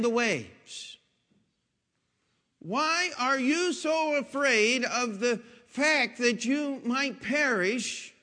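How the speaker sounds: slow, loud, high preaching plea; pleading question. Acted. Jesus asking frightened disciples, slow and loud preaching. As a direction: drag out each why, pressing hard.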